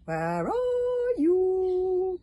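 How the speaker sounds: high, very slow drawn-out call; eerie bird call. A narrator's reading. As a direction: stretch it like a haunting bird cry. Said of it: "called the Neverbirds".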